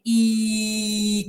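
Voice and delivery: long, steady "i" with the tongue near the palate; vowel demo. Shown as a demonstration. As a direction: hold a pure "i" on one note. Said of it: "la tengo que poner casi en el paladar".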